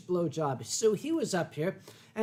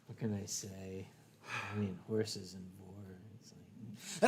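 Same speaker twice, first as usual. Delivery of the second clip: sheepish mumble; 13 semitones lower, 15 dB quieter than usual. Real, not acted. Embarrassed trailing confession.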